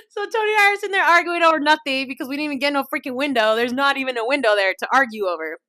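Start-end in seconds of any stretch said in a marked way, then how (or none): 0.1-3.5 fast, laughing through the words; real giggling, louder. Real, not acted. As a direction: crack up while telling it. Laughing at no window.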